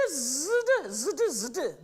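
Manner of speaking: buzzed Z released firmly into D on ZD; articulated ZD. Shown as a demonstration. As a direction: press through the buzz of ZD. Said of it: "you articulate here".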